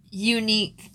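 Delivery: ordinary delivery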